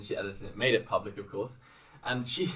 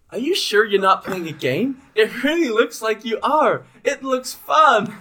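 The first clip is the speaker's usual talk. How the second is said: put-on high, loud, slow child voice; little girl voice; 12 semitones higher, 7 dB louder, slower than usual. The daughter insisting to her mother it looks like a game, in a high child voice. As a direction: plead like an eager little girl.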